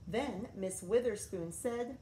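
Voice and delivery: plain reading voice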